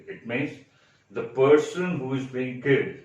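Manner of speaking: ordinary talk, nothing marked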